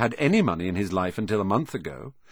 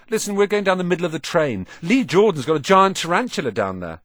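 fast, high and louder; excited twin; 8 semitones higher, 5 dB louder than usual. A narrator's reading. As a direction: rattle it off like an eager prankster.

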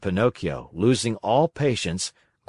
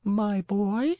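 soft, unhurried, peaceful reply; calm, peaceful; 10 semitones higher, 3 dB quieter than usual. Acted. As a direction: soothe him, no rush. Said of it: "in a calm, peaceful voice".

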